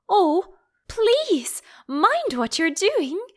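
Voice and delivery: high, pleading cry of alarm; crying out in terror. A narrator's reading. Alice pleading with the Duchess amid flying pans, terrified. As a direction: beg them to stop, frightened. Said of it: "cried Alice".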